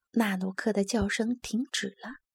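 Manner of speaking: usual speaking voice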